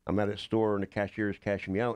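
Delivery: normal talking voice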